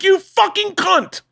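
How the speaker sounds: high, slow, comic outrage; lightly hammed-up bike rage; mock furious rant. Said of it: "I was like".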